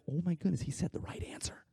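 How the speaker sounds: hushed, trailing into a whisper; amazed aside. Retold or imitated. Disciples to each other about Peter's right answer, amazed whisper. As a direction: drop to a whispered marvel between friends.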